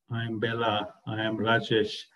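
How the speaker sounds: Bella and Rajesh carry the stress, I am stays light; stress on the names. Shown as a demonstration. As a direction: lean on Bella and on Rajesh, not on I. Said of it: "You don't pay any emphasis on the I".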